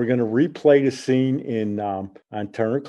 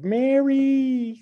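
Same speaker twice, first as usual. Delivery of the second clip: high squealy drawn-out Southern call of "Mary"; goofy drunk Mary call. Retold or imitated. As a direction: squeal "Mary" like a tipsy goofball with a Southern twang. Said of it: "with a Southern accent, like, with this loud, squealy voice".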